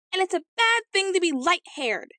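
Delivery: very high-pitched indignant scream. A narrator's reading. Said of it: "screamed she".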